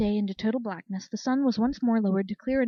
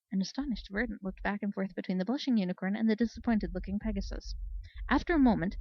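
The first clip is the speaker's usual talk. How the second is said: ordinary delivery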